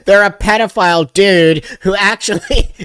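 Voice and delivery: ordinary talk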